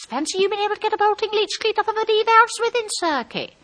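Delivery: very high, sustained marvelling voice; exaggerated high-voiced admiration; high-pitched gushing. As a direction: echo the jargon back way up high. She gushes, echoing his technical words.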